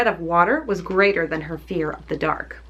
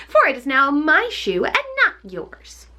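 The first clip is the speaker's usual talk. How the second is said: witch retorting; 6 semitones higher than usual. Acted. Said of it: "retorted the witch".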